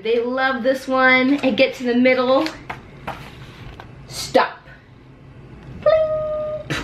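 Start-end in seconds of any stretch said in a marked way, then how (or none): 5.8-6.8 very high ringing bling; sparkly sound effect. Acted. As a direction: sing out a shiny bling.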